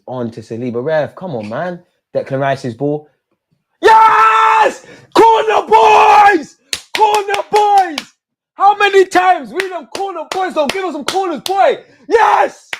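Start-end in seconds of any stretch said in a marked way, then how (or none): 3.8-8.1 screaming with joy, stretched out long; ecstatic goal scream. Real, not acted. Goal celebration, screaming at screen. 8.5-12.7 high, loud celebrating shout; joyful yelling. Real, not acted. Still celebrating the goal.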